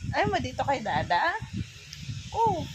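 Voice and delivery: ordinary talk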